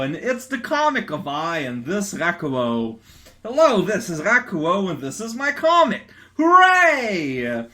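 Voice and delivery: high, loud goofy character reading; hammed-up comic voices. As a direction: ham up the silly dialogue. Reading own silly comic dialogue.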